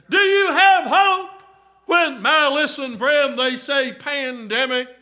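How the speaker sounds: loud, high, rising preacher's cry to the flock; full-force preaching. Acted. Preacher calling to the congregation about hope, full-force preaching. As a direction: preach it out, pleading and building.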